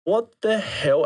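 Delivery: drawn-out, high-pitched disbelief; astonished. Retold or imitated. Runner retells realising his feet match the tempo, astonished disbelief. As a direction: stretch it out, stunned.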